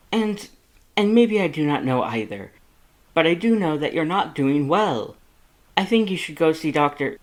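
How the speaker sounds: high, loud, exasperated lecture; worried brother laying down the law; Papyrus scolding. A narrator's reading. Papyrus lectures Sans about drinking, high and loud.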